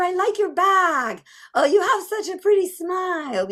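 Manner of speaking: put-on high-pitched child full of compliments; complimenting kid. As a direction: gush sweetly, way up high like a kid. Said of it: "how kids always say".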